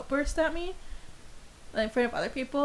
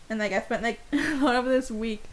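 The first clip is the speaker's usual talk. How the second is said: usual speaking voice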